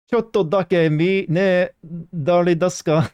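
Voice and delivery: high, slow hammed-up Japanese; mock Japanese. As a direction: sing the phrase up high and slow. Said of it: "Wasn't expecting that".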